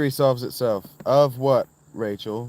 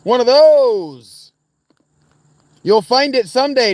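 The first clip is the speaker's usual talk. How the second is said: much higher, drawn-out put-on voice; mock high teasing voice; 12 semitones higher, 12 dB louder, slower than usual. Teasing Danny in chat, squeaky put-on voice. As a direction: tease him in a squeaky fake voice.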